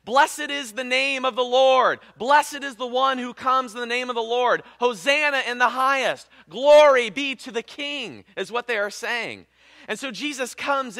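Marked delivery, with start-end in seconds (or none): 0.0-8.2 crowd shouting praise. Retold or imitated. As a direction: call it out like a festive crowd. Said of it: "people are shouting and praising".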